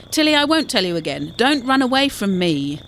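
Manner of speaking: loud, firm mock telling-off; pretend scolding. A narrator's reading. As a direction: scold a runaway pet for show. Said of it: "Quinn pretended to address the mouse".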